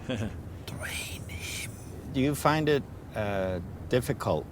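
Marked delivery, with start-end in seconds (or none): 0.1-1.7 breathy whispered aside; quiet whispered order to a companion. Real, not acted. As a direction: whisper it under your breath.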